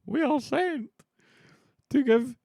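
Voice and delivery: very high, loud, stretched declamation; theatrical royal messenger. Acted. Streamer voices the messengers sent to Macbeth, high and declamatory. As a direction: proclaim it slowly in a high put-on voice.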